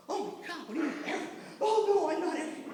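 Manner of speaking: high-pitched mock protest; mock shocked denial. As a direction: squeal a comic, wounded denial. Acts out his indignant denial.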